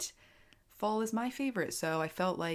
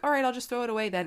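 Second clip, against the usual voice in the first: breezy threat; 5 semitones higher, 4 dB louder, much faster than usual. Retold or imitated. Threatening son over mess.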